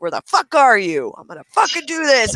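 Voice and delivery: angry voice-chat threat, acted out; furious gamer. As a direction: imitate an enraged player hunting someone down. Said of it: "I could hear that tone".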